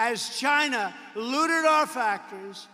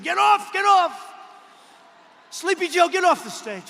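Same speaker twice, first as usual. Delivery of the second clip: loud, high retold yell of get off; hammed-up handlers shouting him off; mock screaming handlers; 7 semitones higher, 10 dB louder than usual. Handlers yelling at Joe to leave, loud and high. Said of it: "So they're screaming".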